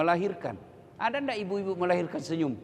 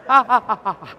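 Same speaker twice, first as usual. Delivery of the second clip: mock cries of pain; 4 semitones higher, 11 dB louder than usual. Mother bitten while nursing.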